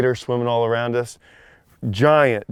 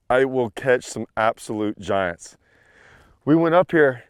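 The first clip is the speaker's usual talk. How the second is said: normal talking voice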